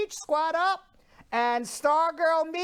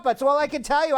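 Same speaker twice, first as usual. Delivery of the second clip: ordinary talk, nothing marked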